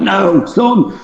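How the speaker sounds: high urgent cry of no; shocked no. Retold or imitated. Dad catching his son mid-wee, urgent high cry. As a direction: snap the boy out of it, pitched high.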